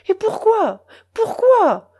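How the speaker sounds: uncle's anguished, tearful demand before the whole room; anguished outcry. A narrator's reading. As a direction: wail the question out in distress. Uncle pleading before everyone.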